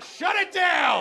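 slow, firm mock command; mock shutdown order. Body ordering the milk to stop, in slow mock command. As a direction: call it like shutting down a factory. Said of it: "you're like".

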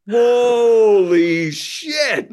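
slow, high hammed-up awe; mock amazement. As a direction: overplay being stunned. Mock amazed reaction to levitation.

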